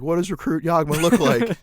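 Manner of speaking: loud, high mock instructor question; drill instructor. Drill instructor quizzing recruits, imitated loud call.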